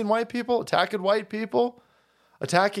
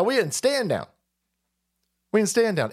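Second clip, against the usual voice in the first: normal talking voice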